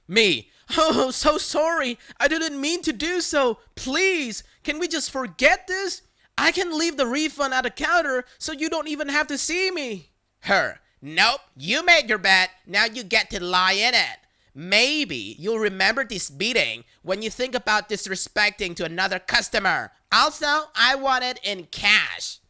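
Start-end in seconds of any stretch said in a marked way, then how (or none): 0.8-10.1 high, whiny fake sobbing plea; hammed-up fake crying to the caller. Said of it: "still fake crying". 11.0-22.4 louder, cold threat; threatening caller. Retold or imitated.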